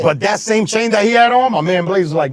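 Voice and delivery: normal talking voice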